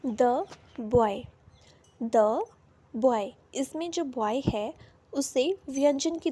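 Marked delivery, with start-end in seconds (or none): 0.0-3.3 "द बॉय" drawn out, with "द" as a short "the"; slow model example. Shown as a demonstration. Teacher slowly models "द बॉय" for students. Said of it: "पहला है".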